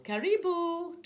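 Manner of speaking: high, loud, drawn-out welcome; calling out. A narrator's reading. Boy welcoming arriving friends, calling out. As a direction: call the greeting out long and high. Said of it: "Adika called".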